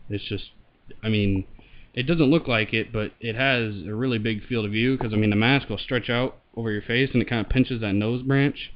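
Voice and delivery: muffled voice behind a mask; talking through a respirator; through a gas mask. Real, not acted. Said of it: "I'll throw it on here and show it, and I'll talk into the mic".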